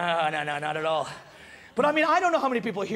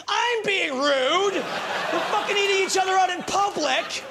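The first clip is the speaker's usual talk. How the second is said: laughing, higher shout of disbelief, slowed; indignant outburst; 7 semitones higher, slower than usual. Retold or imitated. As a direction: blow up in mock outrage. Indignant retort to Victoria.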